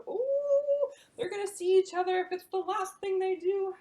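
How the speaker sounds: high, loud eager narration; storyteller's high, loud excitement; thrilled build-up. A narrator's reading. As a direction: hype the reunion.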